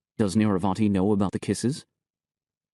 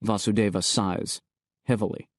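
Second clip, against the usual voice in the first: usual speaking voice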